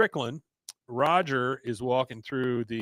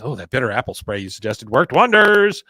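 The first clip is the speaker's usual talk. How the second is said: enthusiastic praise; 7 dB louder than usual. Retold or imitated. Voicing a pleased dog owner.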